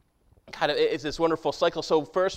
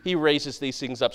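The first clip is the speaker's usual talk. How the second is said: ordinary talk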